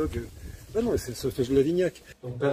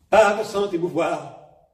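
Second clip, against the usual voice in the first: louder, higher, slower surge; a Gérard Philipe-style burst; sudden shock; 4 semitones higher, 8 dB louder, slower than usual. Retold or imitated. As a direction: let the second line erupt. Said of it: "Et là, oh, c'est un choc".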